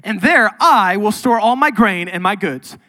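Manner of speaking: mildly hammed-up smug rich man; boastful rich man. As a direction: brag about the bigger barns. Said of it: "Then he said".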